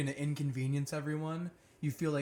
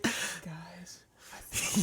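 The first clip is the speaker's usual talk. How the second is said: unvoiced whisper, like a kid at the bedside; creepy whisper; much slower than usual. Kid waking sleeping parents, a creepy whisper. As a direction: breathe it out in a spooky whisper. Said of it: "that's fucking creepy".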